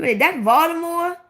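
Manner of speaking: high-pitched, incredulous question; surprised question. Retold or imitated. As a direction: imitate someone who can't believe it. Voicing a surprised viewer's question.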